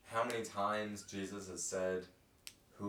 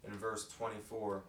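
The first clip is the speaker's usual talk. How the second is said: ordinary talk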